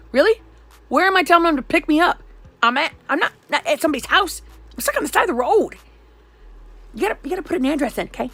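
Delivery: loud, high-pitched indignant outburst; exasperated retort. Retold or imitated. Stranded caller snapping back at the Uber suggestion, exasperated. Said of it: "why a bunch of F-bombs didn't come out".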